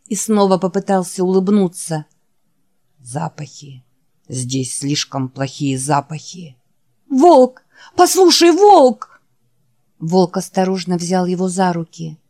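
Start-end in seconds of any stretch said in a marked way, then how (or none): 3.0-6.6 low, soft, worn-out creature voice; barely has the strength to say the name; weary dying Wolf. Acted. Wounded Wolf calling his friend Jack, low and faint. Wolf's voice. 7.1-9.2 high, loud calling out; desperate call. Acted. Jack calling out to the fading Wolf, high loud cry.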